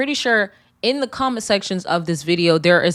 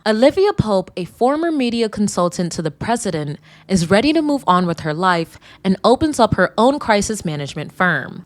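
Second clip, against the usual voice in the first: ordinary talk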